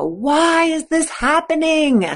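high, slow wail of why; high, slow, pleading question; mock despairing whine. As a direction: drag out the why in self-pity.

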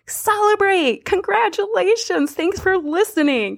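high, cheering thanks to listeners; celebratory congratulations. Real, not acted. Mock-festive congratulations to listeners.

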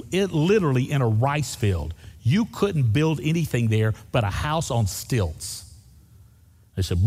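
normal talking voice

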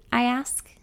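ordinary delivery

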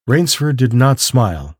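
plain reading voice

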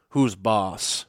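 ordinary delivery

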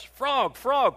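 high-pitched eager call; excited storybook cry. A narrator's reading. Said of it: "cried Toad".

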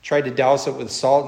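usual speaking voice

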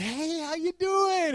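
high, drawn-out hammed-up greeting; upbeat. Customer greeting staff every visit, upbeat. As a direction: stretch it out in a chirpy high voice. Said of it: "I'm just upbeat".

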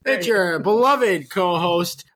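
ordinary talk, nothing marked

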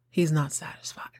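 soft, breathless whispered comment; whispered aside. Real, not acted. Vlogger whispering an aside to viewers, hushed. Aside about Lance's mood.

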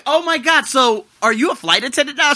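very high, loud gush; retold squeal of surprise; excited amazement. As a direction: ask it thrilled, voice way up. Retold excited question to friend.

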